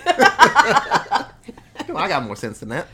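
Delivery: real laughter breaking into the quote; giggling out the punchline; laughing through the line. Real, not acted. Host cracking up while repeating the [name] line to his co-host.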